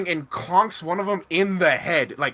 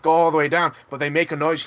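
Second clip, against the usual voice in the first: ordinary talk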